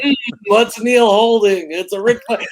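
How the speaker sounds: egging him on loudly; playful loud prompting; mock eager friend. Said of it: "Bill's like".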